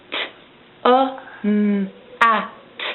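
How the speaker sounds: each sound said apart; segmented sounds. Shown as a demonstration. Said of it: "je vais te dire les sons d'un mot".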